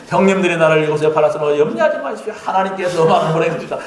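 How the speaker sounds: rushed, trembling Joseph; fast nervous speech. Retold or imitated. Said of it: "말을 빨리 했을 것 같아요". (떨면서 빨리 말하는 요셉)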